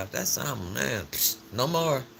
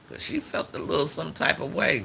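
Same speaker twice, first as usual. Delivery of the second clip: ordinary talk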